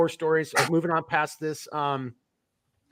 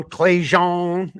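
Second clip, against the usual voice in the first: louder, lightly attempted Cajun accent; 3 semitones higher, 6 dB louder than usual. Shown as a demonstration. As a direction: try the name with a touch of Cajun. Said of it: "There's the Cajun accent".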